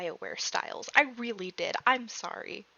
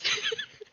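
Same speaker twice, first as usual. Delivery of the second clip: giddy breathless laugh; 6 dB quieter than usual. Real, not acted. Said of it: "I am too happy about this".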